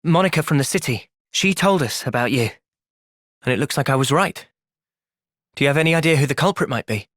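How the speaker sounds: usual speaking voice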